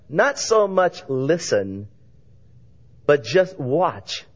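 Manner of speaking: normal talking voice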